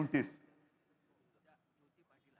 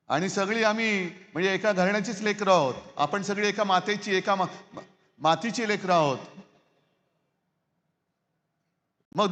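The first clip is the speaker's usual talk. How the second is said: rally speech; 3 semitones higher, 3 dB louder than usual. Acted. Fiery address to rally crowd.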